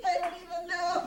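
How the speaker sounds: laughing while talking. Real, not acted. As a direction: laugh through the words. Laughing reply.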